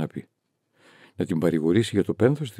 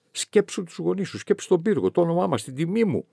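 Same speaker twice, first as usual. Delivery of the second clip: higher, insistent fatherly plea; father pleading; 9 semitones higher than usual. A narrator's reading. Father urges his daughter to marry for the family, insistent plea. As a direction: press her as a desperate father. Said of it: "μου είπε ο μπαμπάς".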